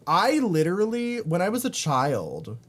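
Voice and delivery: ordinary talk, nothing marked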